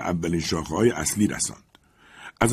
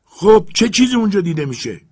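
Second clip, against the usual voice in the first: much higher, louder cry of delight; overjoyed shout; 12 semitones higher, 9 dB louder than usual. Acted. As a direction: burst out with uncontainable joy.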